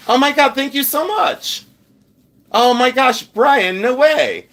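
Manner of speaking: high-pitched, delighted disbelief; stunned gratitude. Real, not acted. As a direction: gush thanks, thrilled and amazed. Stunned by a viewer's super chat.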